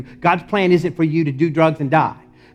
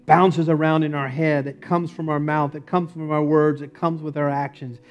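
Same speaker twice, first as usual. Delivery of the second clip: fervent pastoral prayer, rising in force; closing prayer; 3 semitones higher, 4 dB louder than usual. Acted. Pastor praying over the congregation, fervent and building. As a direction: pray over them, pleading and building.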